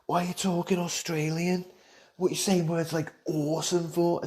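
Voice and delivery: high-pitched mock questioning; relatives back home. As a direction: put on high, incredulous voices of people back home. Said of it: "they'll be like".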